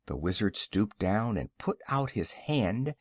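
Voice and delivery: usual speaking voice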